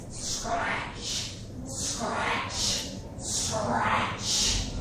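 rasping, whispery scratch, clearly audible. Retold or imitated. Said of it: "a little bit louder".

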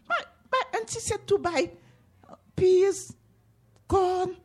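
put-on high, hurried child's voice; small boy's voice. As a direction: rattle off the list like a boy. Boy answers old lady.

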